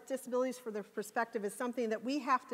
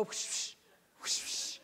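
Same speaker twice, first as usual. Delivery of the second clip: whoosh sounds; 5 dB quieter, slower than usual. Retold or imitated. Imitating the sliding doors.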